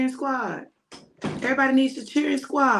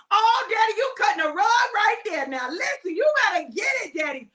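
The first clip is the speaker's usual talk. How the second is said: cheerleader cheer; 8 semitones higher than usual. Retold or imitated. Cheering on her dad.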